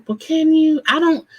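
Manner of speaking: high, disbelieving challenge; incredulous. Retold or imitated. Host mocking a man's boast with incredulity. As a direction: scoff back in shock at him. Her own reaction to Ronald's claim.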